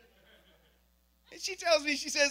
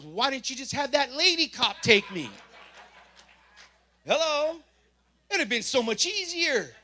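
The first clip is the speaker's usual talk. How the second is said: higher, louder mock whine to God; incredulous complaint; 6 semitones higher, 7 dB louder than usual. Preacher protesting to God, high loud incredulous. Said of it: "my next question to God was".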